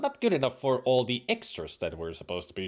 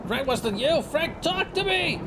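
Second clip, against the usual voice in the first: panicked calling; 13 semitones higher than usual. Acted. Friend shot in the dark.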